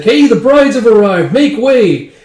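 high, loud crowd-clearing shout; announcing call. Retold or imitated. Announcing the brides' arrival to crowd.

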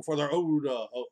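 ordinary talk, nothing marked

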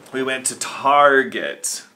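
Target drawn out slowly with a falling tune; odd stress on Target. Real, not acted. Vlogger says Target slowly with a long falling tune. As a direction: stretch Target slowly as you say it. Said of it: "I don't know why I said it like that".

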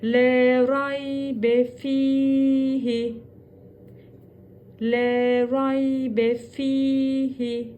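Le raybe fihi chanted very slowly; slow recitation. Shown as a demonstration. Teacher reciting the line slowly. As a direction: draw every syllable out. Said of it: "Sadece fi'yi".

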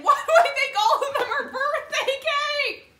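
high, loud laughing outburst; laughing exclamation. Real, not acted. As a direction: laugh it out in disbelief. Laughs at own repeated mistake.

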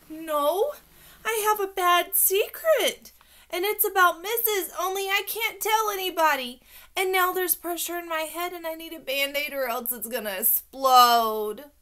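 high, loud worried outpouring; frantic child. A narrator's reading. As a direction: blurt it out in a childish panic. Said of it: "Calm down".

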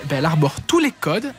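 normal talking voice

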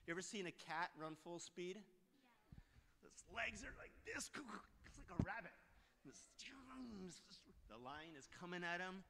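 very soft, near-whispered narration; hushed suspense. A narrator's reading. Preacher tells of the charging lion, hushed. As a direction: hush the room as danger nears.

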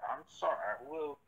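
usual speaking voice

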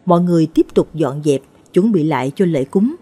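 ordinary delivery

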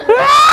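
very high loud wail held like a siren; brother's head out the car window wailing; siren-like scream. Retold or imitated. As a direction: scream steady like an ambulance siren. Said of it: "Perfect siren".

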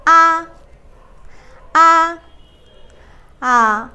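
আ sung through the tones, first tone loud; Mandarin tones. Shown as a demonstration. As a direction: show the tones on আ. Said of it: "আ দিয়ে বলি একবার".

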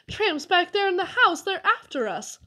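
urgent warning, pitched well up; barely managed. A narrator's reading. Boy telling his club that tramps chase them, barely managed. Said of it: "I managed to say".

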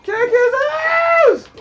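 very high, slow drawn-out scream; long screaming cheer. Real, not acted. Gamer screaming at his fighter to finish the boss, stretched scream. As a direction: hold the shout out as long as you can.

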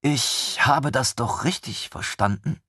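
slow, incredulous question; can't quite believe what she heard; stunned disbelief. Acted. Desina asking the others in slow disbelief. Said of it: "fragte Desina fassungslos".

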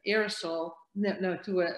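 normal talking voice

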